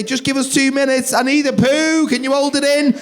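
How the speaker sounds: high, pressed back-and-forth; urgent exchange. Acted. Voices through the toilet door, urgent pleading. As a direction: keep it high and harried.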